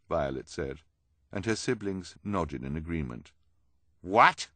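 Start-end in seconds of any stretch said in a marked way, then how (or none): 4.0-4.5 loud, higher, sharp one-word question. A narrator's reading. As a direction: snap it out sharply. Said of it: "Esme said sharply".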